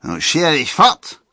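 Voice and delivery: slow, loud, high dismissal; called-out order. A narrator's reading. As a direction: order him off with a shout. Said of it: "rief der".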